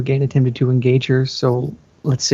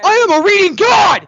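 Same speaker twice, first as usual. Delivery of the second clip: much higher, loud gloating cry; triumphant shout; 4 dB louder than usual. Real, not acted. Reader celebrating the finished story, shouting in triumph. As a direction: crow it to the room.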